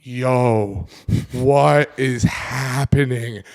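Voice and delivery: slow, stretched-out awe; drawn-out disbelief; slow amazed murmur; awed slow. Retold or imitated. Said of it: "And I'm like".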